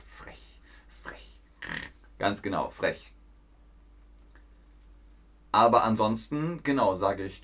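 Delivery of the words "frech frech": whispered quote. Retold or imitated. Streamer reading a viewer's chat message, whispered. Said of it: "Dima sagt".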